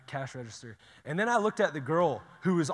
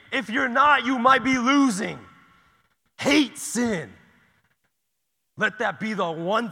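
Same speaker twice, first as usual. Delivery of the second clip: loud, higher preacher's cry against sin; fiery preaching; 8 semitones higher, 12 dB louder, slower than usual. Acted. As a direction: preach it at full force to the room.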